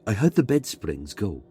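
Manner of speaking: usual speaking voice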